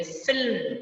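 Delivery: फिल्म with a rubbing fricative f, not ph; nukta f sound. Shown as a demonstration. Teacher showing children how फिल्म is said with nukta. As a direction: let air hiss through the f of फिल्म. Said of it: "पीछे घर्षण होगा हमारी जीभ का".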